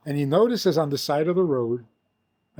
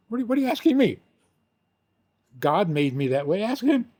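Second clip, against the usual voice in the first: mock indignant; 5 semitones higher, faster than usual. Imagined indignant reply.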